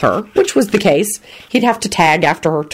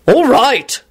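cross, raised-pitch shout; 7 semitones higher, 4 dB louder than usual. A narrator's reading. Embarrassed father stopping the display of emotion, cross shout. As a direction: shout crossly to cut it short. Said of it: "Quentin shouted crossly".

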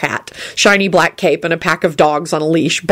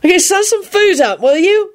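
high-pitched cheerful holler; carefree high call-out; 10 semitones higher, slower than usual. A narrator's reading. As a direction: call down to someone below, pitched right up. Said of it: "he hollered down at Lupin".

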